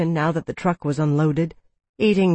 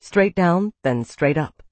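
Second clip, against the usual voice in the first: ordinary delivery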